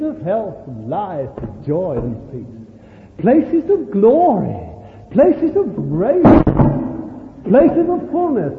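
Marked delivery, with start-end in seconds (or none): 3.2-8.5 loud, slow, rising exultation; worked-up preaching. Real, not acted. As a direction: get carried away proclaiming each phrase. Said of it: "I'm getting worked up".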